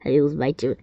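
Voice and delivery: usual speaking voice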